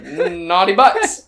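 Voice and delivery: loud, drawn-out title call; mock naughty. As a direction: linger on the silly rude words. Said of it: "that's the episode name".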